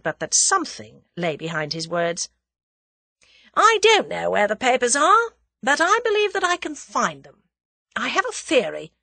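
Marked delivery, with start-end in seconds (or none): 3.5-8.9 higher-pitched, assured declaration; confident. A narrator's reading. Captive makes his offer, confident. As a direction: sell the theory with confidence.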